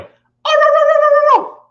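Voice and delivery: very high, loud yapping barks; yappy dog barks. Retold or imitated. Hyper young dog yaps while running around the yard. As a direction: yap like a spastic little pooch. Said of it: "He's all like".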